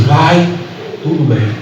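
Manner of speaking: lightly acted hidden bitterness; embittered woman. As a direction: pause after the first word, then let it sink quietly. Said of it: "ela estava uma amargurada".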